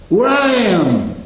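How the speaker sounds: high, ringing preacher's cry; soaring sermon voice; preaching at full force. Acted. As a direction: lift it up and hold it like a sermon climax. Preaching climax, voice soars.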